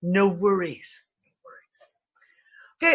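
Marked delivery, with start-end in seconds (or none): 1.2-2.9 very slow, airy whisper; drawn-out whisper. Real, not acted. Host signing off to listeners, slow playful whisper. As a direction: whisper it, stretching out each word.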